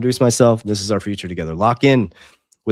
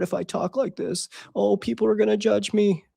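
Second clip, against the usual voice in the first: mock-timid higher voice of his old self; scared old self; 11 semitones higher than usual. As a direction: imitate your old, scared self, voice up high. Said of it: "The old J V was so scared".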